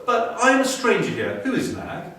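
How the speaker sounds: a bold little mongoose asks; young mongoose. A narrator's reading. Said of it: "said Rikki Tikki".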